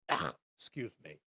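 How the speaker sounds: strained words after a coughing fit; choked up. Real, not acted. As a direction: recover from choking, fading as you go. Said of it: "I got choked up here".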